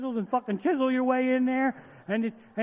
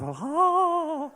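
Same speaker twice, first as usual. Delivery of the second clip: laugh; 7 semitones higher than usual. Real, not acted. Laugh after the bit.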